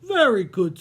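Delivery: pleased giant. Acted. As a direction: boom it out contentedly. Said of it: "said the giant with a mighty yawn".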